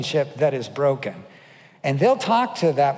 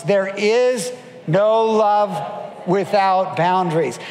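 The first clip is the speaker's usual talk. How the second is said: each word stretched out, "IS" and "NO" held long; slow emphatic repetition; slower than usual. Shown as a demonstration. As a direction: lead a crowd to repeat it, slowly. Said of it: "Maybe repeat it after me".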